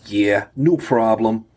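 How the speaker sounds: put-on light New York accent, slow and easy; relaxed New York guard accent. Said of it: "I could recognize his New York accent now".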